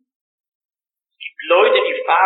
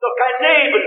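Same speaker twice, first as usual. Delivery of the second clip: desperate cry; 8 semitones higher, 4 dB louder than usual. Retold or imitated. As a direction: cry it out in despair. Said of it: "sagt er ganz verzweifelt".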